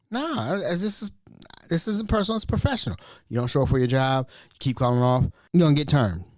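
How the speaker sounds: usual speaking voice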